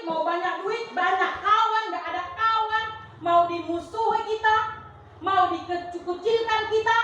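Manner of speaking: seruan khotbah lebih tinggi, pelan dan berirama; khotbah setengah dinyanyikan. Acted. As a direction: lantunkan seperti seruan yang mengajak jemaat bertahan. Preacher chants exhortation, half sung. (pengkhotbah menguatkan jemaat dengan seruan setengah bernyanyi)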